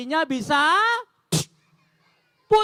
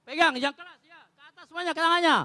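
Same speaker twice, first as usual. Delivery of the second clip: usual speaking voice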